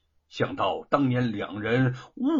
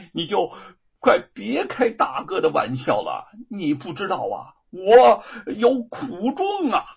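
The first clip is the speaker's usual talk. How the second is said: pained, glum; 5 semitones higher, 5 dB louder than usual. Acted. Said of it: "姚老大苦着脸说".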